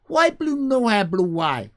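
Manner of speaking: high, loud broken question; chirpy jumbled future English; future generations speech. Retold or imitated. Streamer imitating how future generations will talk, squeaky and broken. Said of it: "They say that, something like that".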